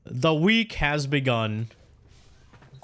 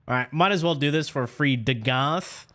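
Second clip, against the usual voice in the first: ordinary talk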